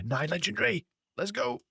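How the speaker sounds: high, whooping cheer of triumph; excited cheer. Real, not acted. Celebrating a legendary animal.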